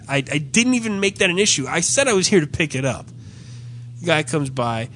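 ordinary delivery

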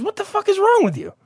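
very high mock-outraged voice; imitated indignant stranger. Guy in line rounding on the storyteller, imitated high outrage. Same guy now turns on speaker.

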